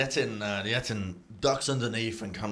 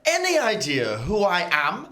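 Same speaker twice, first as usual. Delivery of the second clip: high, loud, indignant lady; haughty captive woman; 13 semitones higher, 5 dB louder than usual. Acted. GM voices the captive woman demanding release. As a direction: demand it like an offended noble. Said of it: "the woman as well is saying".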